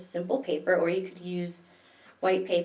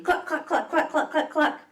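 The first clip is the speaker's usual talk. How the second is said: higher chicken clucks; clucking hens; 8 semitones higher than usual. Acted. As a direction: cluck like a barnyard hen. Chickens clucking over her.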